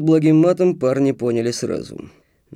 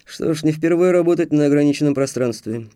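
ordinary delivery, nothing marked

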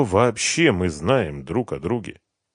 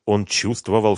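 usual speaking voice

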